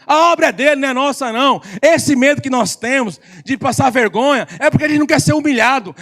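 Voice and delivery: loud, high fervent preaching; preaching at full force. Acted. Pregação em alta voz. (pregação fervorosa, alta e aguda)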